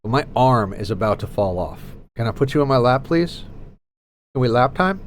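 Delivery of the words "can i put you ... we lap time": ordinary delivery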